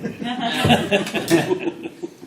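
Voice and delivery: loud high burst of laughter. Real, not acted. Laughs about comfy chairs.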